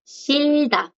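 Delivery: Shilda held long and high, then dropping on da; silent J. Shown as a demonstration. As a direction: model Shilda slowly, R sounding as L and no h. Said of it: "es que la J no suena".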